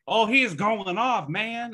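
high, drawn-out excited commentator impression; Boyer. Podcaster mimics Boyer hyping the song, high mock commentary. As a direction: stretch it out high, like a hyped-up booth commentator. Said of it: "And Boyer's gonna be like".

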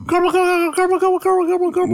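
squeaky high gibberish chant; high silly garble. Pretend. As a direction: toss it off in a tiny high voice. Mock garbled talk, very high.

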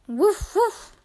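high playful puppy woofs; puppy barking. Pretend. Narrator voicing Biscuit the puppy for kids, high woofs. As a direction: bark like a happy little puppy.

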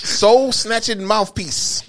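slow announcer intro, words stretched out; announcer drawl. Acted. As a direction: announce it like a show title. Announcing show segment.

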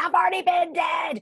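panicked protest from the stretcher; exasperated outburst. Retold or imitated. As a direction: blurt it out, frantic and disbelieving. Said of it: "And I'm going".

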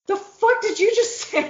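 high, laughing mock indignation; half-laughing, pretend-offended retort; outraged question through laughter; laughing mock outrage. Her reaction to the phrase.

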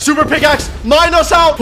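high, loud panicked command; urgent yell. Real, not acted. Gamer fleeing the wither, urgent yell. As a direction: shout it as you scramble to escape. Escaping the boss.

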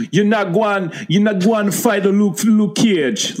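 high, hammed-up Jamaican patois; exaggerated Jamaican movie-villain accent; mock Jamaican accent. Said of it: "I do a better Jamaican accent".

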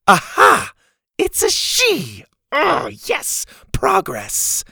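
high triumphant cry of discovery; gleeful, pitch soaring; excited. Acted. Character triumphs at discovering a clue.

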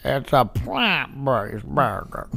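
low, slow 1930s cop drawl; old-timey cop voice. Retold or imitated. Impersonating Louie Anderson.

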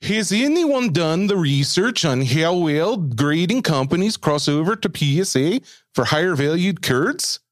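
put-on slow, high Norwegian accent; Scandinavian accent. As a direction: read it slowly in a thick Norwegian accent. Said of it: "try your best Scandinavian accent here".

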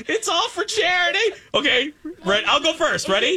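nervous high voice. Real, not acted. Bracing to eat the rotten sauce.

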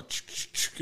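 soft, voiceless "ch" bursts; imitated prying noise. Friend works the door with butter knife, soft scraping noises. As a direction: mimic a knife jimmying a lock. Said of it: "And he's all".

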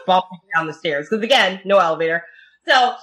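usual speaking voice

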